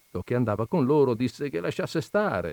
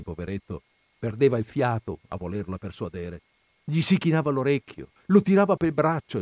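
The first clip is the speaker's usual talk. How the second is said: ordinary delivery, nothing marked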